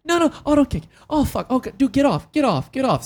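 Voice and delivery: high, frantic pleading at a wire; panicked high voice. Retold or imitated. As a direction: squeal out warnings in a panic. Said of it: "You're like".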